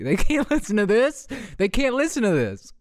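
high, laughing protest. Real, not acted. Host cracking up at their own joke, laughing. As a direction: crack up while insisting.

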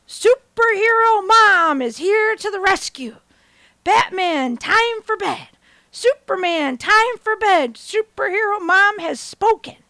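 loud, slow, grand heroic proclamation; superhero mom. Retold or imitated. Mom announcing bedtime to her boys, grand superhero boom. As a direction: boom it like a caped hero. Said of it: "hands on hip, stand tall".

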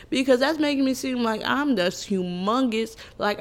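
ordinary delivery

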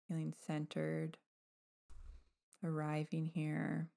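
guided meditation. Acted. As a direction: gently guide them to let go, with long pauses.

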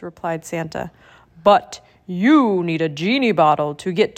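put-on a warm, jolly old Santa, fuller in tone. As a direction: speak as a kindly Santa Claus. Narrator voices Santa.

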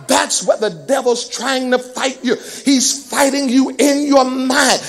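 preaching that builds to a loud push; driving preaching. Real, not acted. Preacher tells worriers the devil fights in the mind, driving preaching. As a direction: press each clause on the congregation, gathering force.

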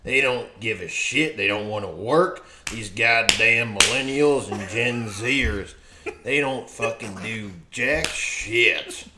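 put-on lightly done grumpy country old-timer; a hint of a rural Maryland local griping about kids; country accent. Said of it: "That sounded very country".

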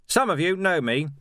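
calls out loud and high to a crowd; shouting. A narrator's reading. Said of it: "he shouted".

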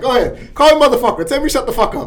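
high, loud goading; egging him on; taunting dare. Retold or imitated. John goading Kevin to curse him out, high and loud.

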